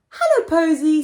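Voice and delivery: lightly voiced little boy; Pip greeting. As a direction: call a friendly hello as the boy in the costume. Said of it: "said Pip".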